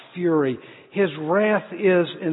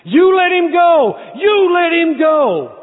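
full-throated high, loud shout; yelling at top of lungs; 12 semitones higher, 10 dB louder than usual. Retold or imitated. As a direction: yell it like a mother charging at an attacker. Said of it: "yelling at the top of the, her lungs".

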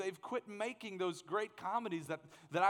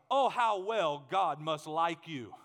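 loud, slow, ringing psalm line; emphatic proclamation; 7 dB louder, slower than usual. Real, not acted. Preacher wanting to be the psalmist's man, loud proclamation. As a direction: declare it slowly at full voice.

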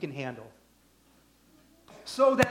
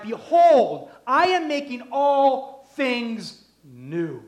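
BEHOLD louder, then a slow, weighty line; emphatic; 4 semitones higher, 10 dB louder, much slower than usual. Retold or imitated. Jesus in Revelation, declared slowly and emphatically. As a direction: proclaim it slowly with full emphasis. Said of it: "emphatic, emphatic tones".

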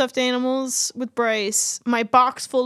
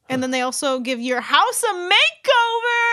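higher, louder, building to the last word; sing-song hype; 7 semitones higher, 5 dB louder than usual. Pretend. Pitching the show to co-host, excited. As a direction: hype it up like a prize. Hyping the show's makeover.

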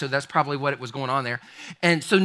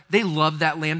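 usual speaking voice

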